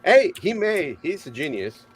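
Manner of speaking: ordinary talk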